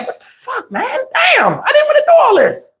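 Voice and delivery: high, whimpering mock cry; mock whining. Comedian whimpers high about being dragged onto rides. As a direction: whine it like a scared kid. His own dread, voiced comically.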